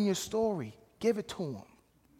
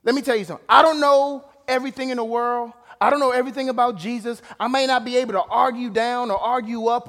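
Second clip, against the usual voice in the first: usual speaking voice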